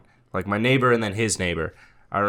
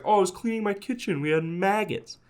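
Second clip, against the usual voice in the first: meek excuse; 10 semitones higher, 4 dB quieter than usual. Retold or imitated. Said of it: "And the guy's like".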